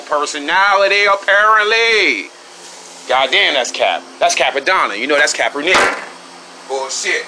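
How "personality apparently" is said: sarcastic. Real, not acted. Mocking a critic's claim.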